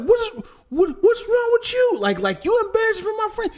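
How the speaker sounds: high, indignant shout; upset teen. Retold or imitated. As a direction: protest like an embarrassed teen. Daughter retold, upset at dad.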